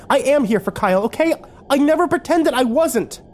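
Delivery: high-pitched, defensive insistence; insistent protest. Acted. Arguing with Kimber about being here for Kyle, insistent protest. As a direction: push back hard, voice climbing high. Heated argument over real motives.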